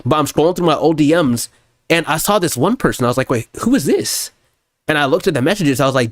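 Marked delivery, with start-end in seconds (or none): 3.3-4.3 slow, higher puzzled double take; mock surprise finding an old message; surprised. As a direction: stop short, who is this. Finds old DM.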